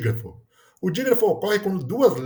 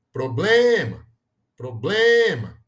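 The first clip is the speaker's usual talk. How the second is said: "problema" called out with a long, loud BLE; proBLEma, swelling on the second syllable; called-out word, middle syllable stressed; 5 dB louder, much slower than usual. Shown as a demonstration. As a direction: stretch the BLE in "problema" as if calling. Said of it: "vamos chamar a palavra problema".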